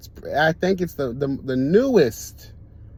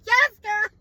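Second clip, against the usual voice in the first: hyped laughing cheers; 7 semitones higher, much slower than usual. Real, not acted.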